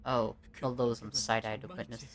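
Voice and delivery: plain reading voice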